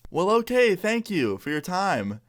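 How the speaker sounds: high start, slowing to a close; lightly mocked polite brush-off; awkward polite dismissal. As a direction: imitate a thrown interviewer wrapping up. Reporter awkwardly ends the interview.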